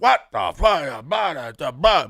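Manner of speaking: a drawn-out, slow mock curse at the situation; dad's frustrated outburst. Dad fed up with a situation, dragged-out mock exasperated curse. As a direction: imitate dad venting, not angry at anyone. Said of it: "he gets mad".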